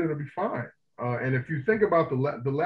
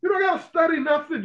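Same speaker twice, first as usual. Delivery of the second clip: pitched way up and louder, fed up; exasperated rant; 12 semitones higher, 4 dB louder than usual. Real, not acted. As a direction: vent it like you've had enough of explaining. Ranting about racism being common sense.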